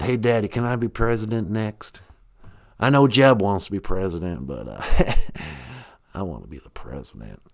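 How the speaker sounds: put-on low, smug good-old-boy president; George Bush. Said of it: "And I can do George Bush".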